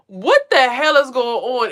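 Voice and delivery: what is happening to me; bewildered. Retold or imitated. Lost in a vision, bewildered, slow and loud. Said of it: "And I'm like".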